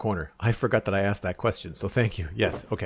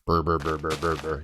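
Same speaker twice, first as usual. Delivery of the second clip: low buzzing burr sounds, mimicking the bass; imitating bass hum; 4 semitones lower than usual. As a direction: hum the constant rumble in his head. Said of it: "just this constant, like".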